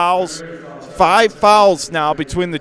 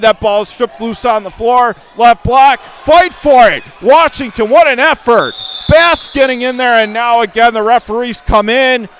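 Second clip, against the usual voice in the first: excited call; 5 semitones higher, 6 dB louder than usual. Real, not acted. Scramble for loose ball.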